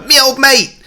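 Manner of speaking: loud, high cockney greeting; delighted scream. Acted. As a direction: whoop it out to a long-lost pal. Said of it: "Dodger screams in delight".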